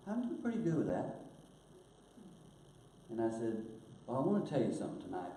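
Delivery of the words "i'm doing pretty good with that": put-on child's voice. Grandson answering.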